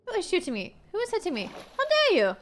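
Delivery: high affronted shriek climbing to how dare you; mock indignation. Streamer hit by other players, shrieking in mock indignation. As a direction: play the scandalised grande dame.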